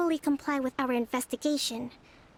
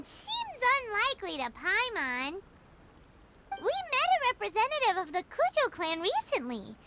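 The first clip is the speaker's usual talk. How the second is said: high, lively little companion chatter; bubbly sidekick, curious and quick; Paimon's voice; 7 semitones higher than usual. Acted.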